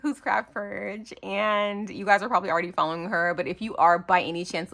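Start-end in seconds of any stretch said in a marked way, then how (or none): none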